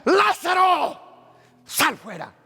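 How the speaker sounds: high, loud cry of Lázaro; commanding authority. Acted. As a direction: command the dead to rise. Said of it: "con una autoridad".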